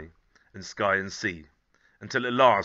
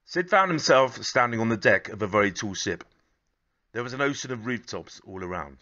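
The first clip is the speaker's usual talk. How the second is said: story narration; 5 dB louder than usual. A narrator's reading. Reads a picture book aloud.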